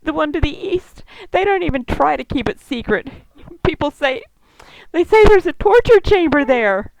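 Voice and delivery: high, tearful, fearful telling; holding back sobs. A narrator's reading. Elf woman describing the horrible camp, holding back sobs. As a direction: keep the sobs down, pitch climbing high. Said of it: "holding back sobs".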